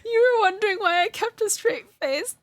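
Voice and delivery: giggling embarrassed remark; laughing while talking. Real, not acted. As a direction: let the laugh break the line. Laughing at own mishap.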